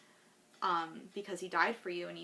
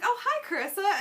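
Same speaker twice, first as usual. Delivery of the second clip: much higher, louder friendly nurse greeting; cheerful nurse; 12 semitones higher, 8 dB louder than usual. Retold or imitated. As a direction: imitate the nurse recognizing her. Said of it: "and she's like".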